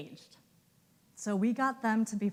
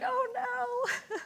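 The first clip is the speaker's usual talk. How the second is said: mock dismay. Cornered in hallway.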